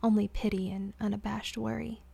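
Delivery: ordinary delivery, nothing marked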